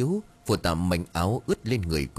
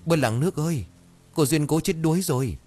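panicked cry; 8 semitones higher than usual. A narrator's reading. Villager shouting the drowning news to everyone, panicked cry. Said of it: "Tiếng kêu thảng thốt của ai đó".

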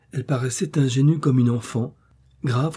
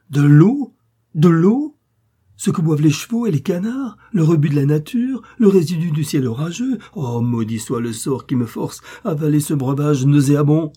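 loud, high, disgusted outburst; theatrical loud indignation; outraged father; 4 semitones higher, 7 dB louder than usual. A narrator's reading. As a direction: rant in mounting disbelief and disgust. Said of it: "s'écria son père en démence".

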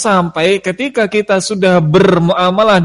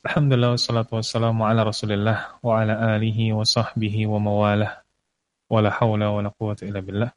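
quick, low Arabic praise formula; recited opening praise; 7 semitones lower, 7 dB quieter, faster than usual. Acted. Preacher opens with quick praise to God. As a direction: rattle off the opening du'a.

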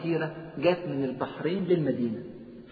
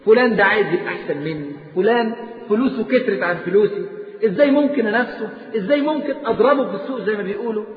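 louder, higher, urgent run of questions; impassioned preaching; 5 semitones higher, 6 dB louder than usual. Acted. Preacher mocks the greedy rich man's worries, loud urgent preaching. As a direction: pile the worried questions on, pressing harder. Voices anxious rich man.

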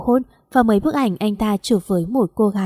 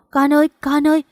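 put-on high, worried call to a child; alarmed calling; 6 semitones higher than usual. A narrator's reading. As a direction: call out to your child in alarm, pitch lifted. Mother calls to distressed daughter.

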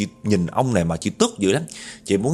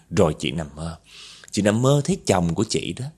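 usual speaking voice

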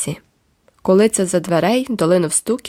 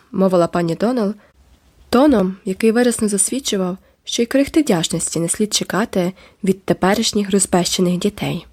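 usual speaking voice

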